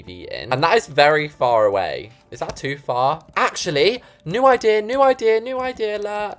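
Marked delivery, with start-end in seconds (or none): 3.4-4.8 high, loud excited announcement. Real, not acted. Builder announcing a new idea, excited. 4.8-6.4 high, loud sing-song alert; excited. Real, not acted. Builder calling out to viewers, excited. Repeats new idea playfully.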